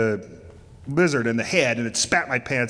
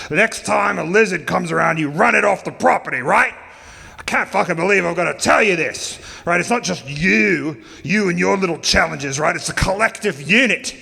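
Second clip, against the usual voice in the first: higher, louder scolding rant; angry lecture; 4 semitones higher, 4 dB louder than usual. Retold or imitated. Craig scolding Jeff about camp duties, angry lecture. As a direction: lay down the law hard.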